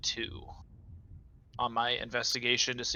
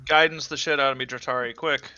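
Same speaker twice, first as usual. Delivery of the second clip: urgent loud plea; 3 semitones higher, 8 dB louder, much faster than usual. Acted. Urgent call for help before a trap.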